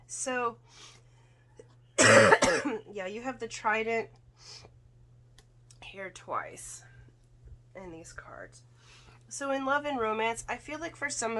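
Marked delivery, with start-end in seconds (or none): 2.0-3.1 coughing, throat scratchy; clearing a rough throat; after a cough. Real, not acted. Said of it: "excuse me". 5.8-8.6 whisper. Real, not acted. Drops to a whisper.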